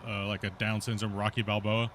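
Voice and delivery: normal talking voice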